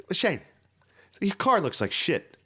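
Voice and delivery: high-pitched mock scolding; furious dad. Dad seeing his son's sideswiped car, mock fury. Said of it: "He was furious".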